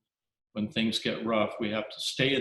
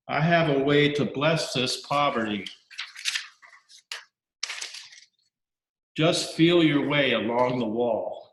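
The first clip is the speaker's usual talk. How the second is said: poem reading; 2 semitones higher than usual. Acted. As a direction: recite the poem with weight. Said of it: "I'll leave you with a little poem".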